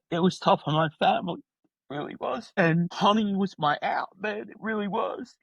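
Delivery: real emotion breaking through, understated; getting emotional. Real, not acted. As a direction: voice rising high and tight, fighting back tears. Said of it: "I get a little emotional".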